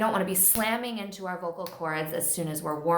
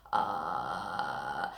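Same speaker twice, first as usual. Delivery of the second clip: vocal fry; 6 dB quieter than usual. Shown as a demonstration. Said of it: "sense of the vocal fry".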